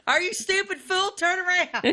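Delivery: high, loud sassy Madea scolding the driver. Pretend. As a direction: bark it like a bossy auntie. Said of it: "Madea accent would be awesome".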